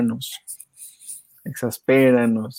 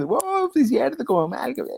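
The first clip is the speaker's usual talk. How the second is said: higher, amazed wow; excited; 12 semitones higher than usual. Retold or imitated. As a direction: gush like a delighted fan. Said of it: "el de Malcolm te fue".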